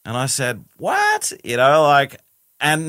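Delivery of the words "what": a sudden very high squeak of disbelief; shocked what. Retold or imitated. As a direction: jump right up in pitch, stunned. Shocked reply to producer.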